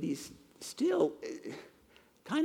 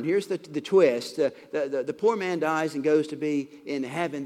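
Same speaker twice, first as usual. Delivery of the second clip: ordinary talk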